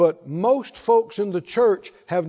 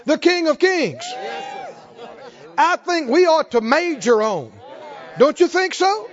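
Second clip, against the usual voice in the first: fervent preaching; 6 semitones higher than usual. Acted. Preacher rousing the saints with a faith litany, fervent. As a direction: build the list like a revival preacher.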